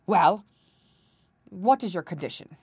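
loud grudging opener, then easing; growled. A narrator's reading. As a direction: give in with a mild grumble. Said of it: "growled the king".

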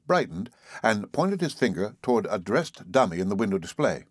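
plain reading voice

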